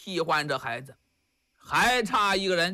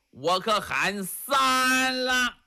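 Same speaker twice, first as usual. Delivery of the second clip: slow threatening shout; 4 semitones higher, much slower than usual. Acted. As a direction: stretch it out and lean on it like a final threat. Monk threatens to call three.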